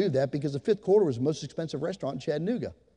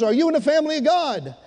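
booming challenge; 10 semitones higher, 6 dB louder than usual. Retold or imitated. Reggie presses the question again.

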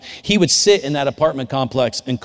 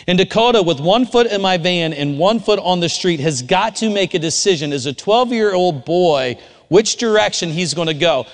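preaching at full force; 6 semitones higher, 5 dB louder than usual. Acted. Builds the sermon story to a climax.

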